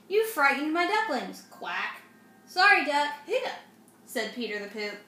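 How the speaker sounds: lively storybook reading; reading to children. A narrator's reading. Mom reads a picture book aloud to kids. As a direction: read it brightly to little ones.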